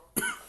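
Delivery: sharp choking cough; coughing fit. Real, not acted. Coughing mid-sentence.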